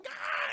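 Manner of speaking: high-pitched yell of the stabbed; imitated dying shriek; mock death cry.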